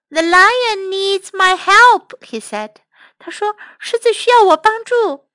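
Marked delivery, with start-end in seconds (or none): 0.1-2.2 high, slow mouse voice; concerned little mouse. Acted. Mouse hears the lion roar, concerned. 3.8-5.3 high-pitched mouse voice; concerned little mouse. Acted. Mouse decides the lion needs help, concerned.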